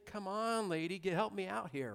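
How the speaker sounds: hammed-up helpless officer, hands in the air; throwing hands up. Host imitating the officer giving up on the lady, whiny. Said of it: "he's throwing his hands up in the air".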